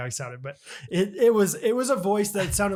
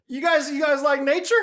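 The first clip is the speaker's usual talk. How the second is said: lightly voiced old neighbor; a hint of the chatty neighbor; mild imitation of the neighbor asking about nature; terrible impersonation of neighbor; 8 semitones higher than usual. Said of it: "I'm doing a terrible impersonation".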